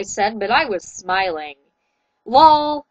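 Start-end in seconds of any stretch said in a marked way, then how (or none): 2.3-2.8 loud teasing shout of Lol. Acted. Friend shouting at the embarrassed singer, loud teasing call. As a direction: call it out loud across the crowd. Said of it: "shouted Ra-, Rochelle".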